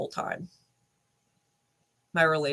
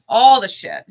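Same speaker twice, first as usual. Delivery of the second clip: louder emphatic repeat; 5 semitones higher, 10 dB louder than usual. Real, not acted.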